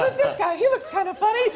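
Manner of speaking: very high-pitched mocking impression. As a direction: squeal it in a mock celebrity voice. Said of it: "Look at me and go".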